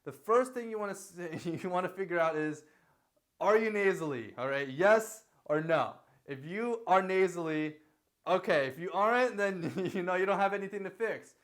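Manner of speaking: usual speaking voice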